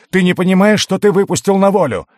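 high, loud desperate shout. Acted. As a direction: yell out the warning. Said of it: "вскричал маг".

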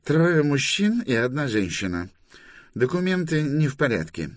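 high-pitched official report; reporting inspector. Acted. Inspector reports the crew into his microphone, officious. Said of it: "быстро проговорил в него".

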